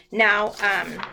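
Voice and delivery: usual speaking voice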